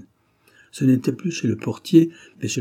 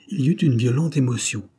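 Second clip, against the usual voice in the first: usual speaking voice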